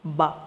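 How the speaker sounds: BA said as one open syllable; syllable sound. Shown as a demonstration. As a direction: sound out BA for learners. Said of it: "what is the sound".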